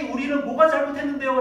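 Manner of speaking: loud, high indignant protest; indignant complaint. Retold or imitated. People protesting to God, loud and indignant. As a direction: throw the question back defiantly. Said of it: "라고 불평했습니다".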